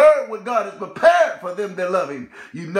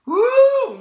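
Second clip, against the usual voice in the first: high, loud joyful whoop; exultant woo shout; 13 semitones higher, 4 dB louder than usual. Real, not acted. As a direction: let out a triumphant whoop. Preacher exults after refrain.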